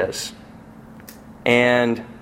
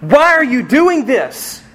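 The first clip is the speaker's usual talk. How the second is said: higher, slow anguished cry; slow, pleading outcry pitched high; crying out to God; 10 semitones higher, slower than usual. Retold or imitated. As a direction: demand an answer from God in anguish. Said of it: "maybe we've screamed at Him".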